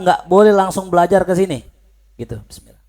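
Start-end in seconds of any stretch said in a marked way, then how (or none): none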